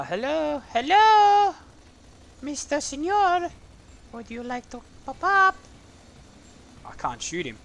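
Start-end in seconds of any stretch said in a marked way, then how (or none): 0.0-5.6 squeaky high teasing voice; high mocking taunt. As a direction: coax the enemy out like a cartoon. Taunting enemy in game.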